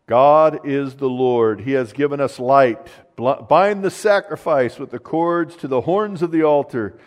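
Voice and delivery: ringing pulpit voice; preaching at full force. Acted. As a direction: declare it to the congregation. Proclaims the verse loudly.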